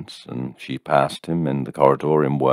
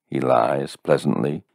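usual speaking voice

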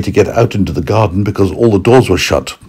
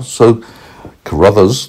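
usual speaking voice

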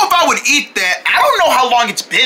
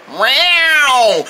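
cat meow; 2 semitones higher than usual. Acted. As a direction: meow like a cat just found. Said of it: "You guys found the cat".

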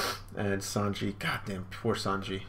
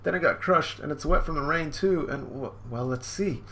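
put-on higher, flustered little-boy apology; sheepish young Sanji; 7 semitones higher than usual. Young Sanji apologizes to his mother, high and flustered. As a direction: babble an apology like a nervous kid. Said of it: "He's like".

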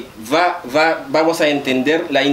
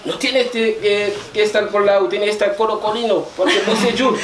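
higher-pitched voicing of the comrades' objection; quoted compañeros; 5 semitones higher than usual. Speaker quoting comrades about Bocellur, imitated higher voice. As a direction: retell the comrades' words in a raised voice. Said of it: "los compañeros dicen".

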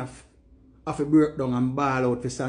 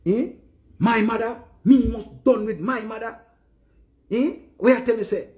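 indignant outburst; 7 semitones higher than usual. Real, not acted. Outraged at being told forget.

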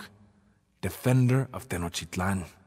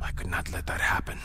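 whispered, softer, with quiet determination; resolute whisper; 5 semitones lower, 7 dB quieter than usual. Acted. Emperor vowing to protect his weakened city, in a determined whisper. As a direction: say it under your breath like a vow to yourself.

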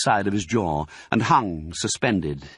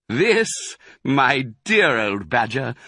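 grand, higher-pitched announcement; proudly proclaiming; 5 semitones higher, 4 dB louder than usual. A narrator's reading. Fox shows Badger the storehouse, proudly proclaiming. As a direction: proclaim it to an old friend. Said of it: "proclaimed Mister Fox".

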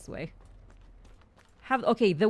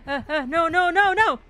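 higher and louder, rising no's; panicked cry; 7 semitones higher, 7 dB louder than usual. Real, not acted. Player caught off guard in the game, panicked. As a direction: blurt out rapid, alarmed no's.

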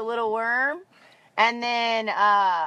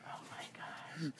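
stunned whisper; 8 semitones lower, 24 dB quieter than usual. Real, not acted. A shocking Halloween reveal.

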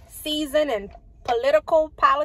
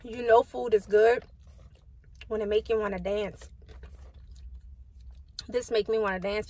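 talking between bites; chewing while talking; 4 dB quieter than usual. Real, not acted. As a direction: mouth partly full. Seen 0: Mouth moving on food, cheeks full around 4.0-4.8s, eating throughout.

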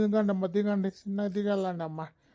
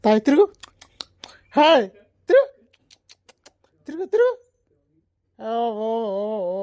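calling to bullocks; 6 dB louder than usual. Acted. Cart driver shouting commands at his bullocks, loud. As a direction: yell out to turn the bulls.

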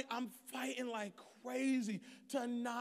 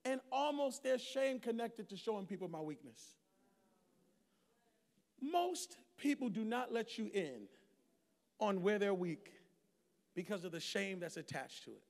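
ordinary talk, nothing marked

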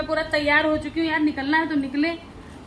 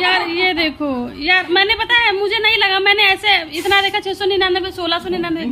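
loud, higher-pitched excuse-making; flustered protest; 5 semitones higher, 7 dB louder than usual. Real, not acted. Wife explaining the misread tag to husband, loudly protesting. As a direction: argue your innocence in a raised voice.

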